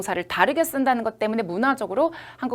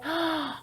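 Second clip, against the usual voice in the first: gasp; 6 semitones higher, 9 dB quieter than usual. Acted. Student realizes near trap.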